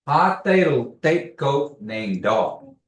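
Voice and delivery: Ha te lo te ko name dog said slowly and loud; slow model phrase. Shown as a demonstration. Teacher modeling a Kiowa phrase, slow and clear. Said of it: "let's practice saying".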